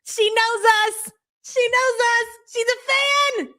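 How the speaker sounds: high, drawn-out gleeful squeal; mock fangirl excitement. Co-host teasing that the streamer watches them, mock fangirl excitement.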